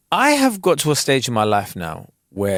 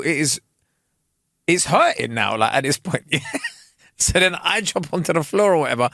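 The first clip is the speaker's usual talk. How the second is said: usual speaking voice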